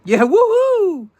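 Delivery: high, slow whoop of joy; excited cheer. Real, not acted. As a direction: cheer it out big. Cheering on a live stream.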